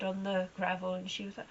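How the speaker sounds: normal talking voice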